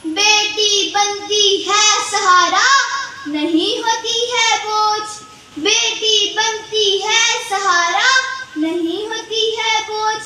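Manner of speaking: chanted closing couplet with held line endings; poem recitation. Acted. Closing a poem with an appeal to change thinking, sing-song recitation.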